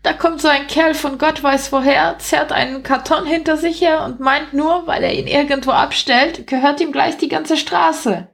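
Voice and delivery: high-pitched, put-out little rat; indignant rat. A narrator's reading. Rat protesting the newcomer's claim to the street, high and indignant. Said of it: "empörte sich eine der Ratten".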